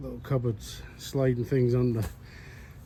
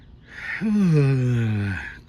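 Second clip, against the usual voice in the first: tired sigh; 5 dB louder than usual. Real, not acted. Tired after building.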